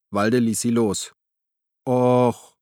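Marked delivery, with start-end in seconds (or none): 1.9-2.6 disappointed, louder moan; complaining. A narrator's reading. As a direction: whine your disappointment. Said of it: "beschwerte sich Gabi". (maulend, beschwert sich)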